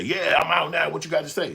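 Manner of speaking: loud, high imagined taunt; calling them out after the crowd; hammed-up loud challenge; mock challenge. Imagined confronting the guys.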